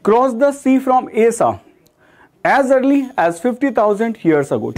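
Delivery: slow, careful second reading of the sentence; slow reread. Shown as a demonstration. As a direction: read it again slowly for students. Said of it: "teacher rereads example sentence". (वाक्य दोबारा धीरे पढ़ना)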